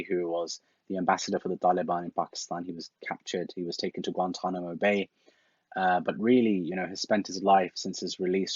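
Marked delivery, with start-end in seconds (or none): none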